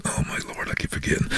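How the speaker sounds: whispered aside to himself; inner whisper. Real, not acted. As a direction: breathe it out as a private thought. Thinking to himself, caught out.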